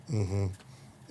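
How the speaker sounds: quiet, dismissive mm-hmms; barely-bothered acknowledgement, acted out; cocky, uncaring. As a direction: imitate someone half-listening, softly. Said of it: "Cocky, didn't give a shit".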